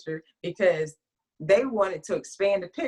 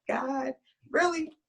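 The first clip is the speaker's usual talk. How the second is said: slow, higher, pleading complaint; exasperated plea to God; 7 semitones higher, slower than usual. Retold or imitated. Complaining to God about putting her picture on the book. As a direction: drag it out in disbelief.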